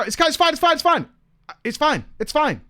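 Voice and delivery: pushy reassurance. Retold or imitated. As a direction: brush off the staff guy's doubts eagerly. Arthur insisting to staff guy.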